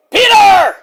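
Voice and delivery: very high, loud holler; Roddy. Pretend. Wrestler hollering at Peter, yelled impression. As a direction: yell the name like an over-caffeinated wrestler. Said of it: "If I ever meet Roddy again, he might say".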